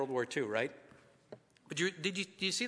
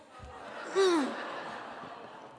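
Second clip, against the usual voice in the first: breathy caught-out sigh; rueful sigh; 12 semitones higher than usual. Real, not acted. Caught by his wife, a rueful sigh. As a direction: let out a busted sigh.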